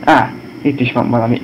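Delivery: ordinary delivery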